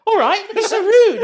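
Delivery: offended woman. Retold or imitated. Offended passenger mocking the rude commuter, high squeal. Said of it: "And then she went".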